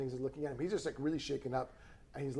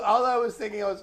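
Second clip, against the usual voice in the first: ordinary delivery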